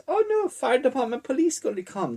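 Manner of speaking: high, hurried falsetto alarm; mock panic. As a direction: fret in a high, rushed voice. Man panics in retold story.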